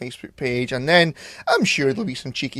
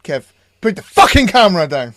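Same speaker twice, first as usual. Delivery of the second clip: loud, raised-pitch scolding shout; mock telling-off; 5 semitones higher, 10 dB louder than usual. Acting out scolding Kev on the film set, mock angry shout. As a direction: yell it like an exasperated boss. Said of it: "And then I'll be".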